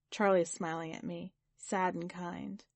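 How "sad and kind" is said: ordinary delivery, nothing marked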